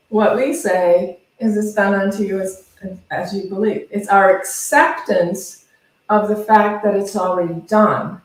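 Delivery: ordinary delivery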